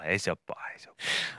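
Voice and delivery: whispered, voice dropped away. Acted. Whispering to her friend that it's not bad. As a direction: whisper it like a secret. Whispered reassurance about cigarette.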